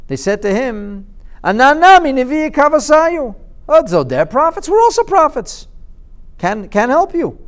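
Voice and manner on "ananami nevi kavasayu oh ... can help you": high, loud smug swagger; smug false prophets selling themselves; boastful prophets. Acted. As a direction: brag like con-men.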